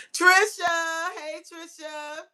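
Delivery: high, drawn-out singsong hello; squealed greeting to a friend; excited greeting. Real, not acted. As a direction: call the name out high and stretched. Greeting a viewer who joins.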